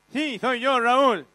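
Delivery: a damaged voice that jumps out of control; voice breaking. Acted. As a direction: voice cracking up high and loud in bursts. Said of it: "¿qué te pasó en la voz?".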